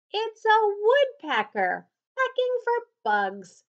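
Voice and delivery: high, loud eager announcement; proudly name the bird; excited. A narrator's reading. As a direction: Gilbert naming the woodpecker. Gilbert explaining excitedly.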